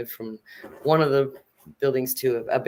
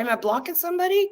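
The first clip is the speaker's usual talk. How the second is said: overly polite mock question; 13 semitones higher, faster than usual.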